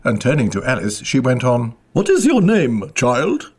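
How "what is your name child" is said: put-on high queen's questioning of a child; high, commanding queen voice; imperious queen. A narrator's reading. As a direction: demand it haughtily, starting high. Queen questions Alice in story.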